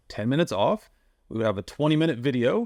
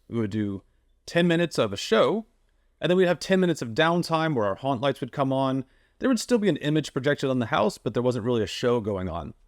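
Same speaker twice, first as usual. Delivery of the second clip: normal talking voice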